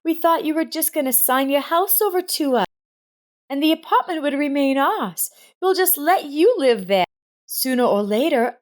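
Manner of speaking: a hint of a grown daughter laying out her plan; entitled daughter. A narrator's reading. Rita voiced in the story.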